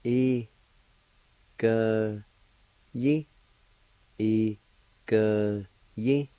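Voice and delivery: slow syllable drill. Shown as a demonstration. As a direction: break the word into separate loud syllables. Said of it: "It's pronounced like this".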